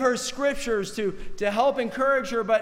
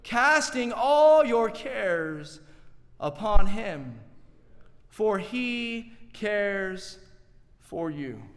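slow, fading close; much slower than usual. Acted. Preacher quotes scripture to the congregation, slow and fading. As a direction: draw each phrase out and let it fade. Closing the point slowly.

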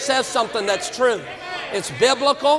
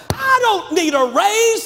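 mock declaration; 9 semitones higher, 4 dB louder, slower than usual. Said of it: "I said".

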